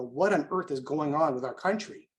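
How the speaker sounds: ordinary delivery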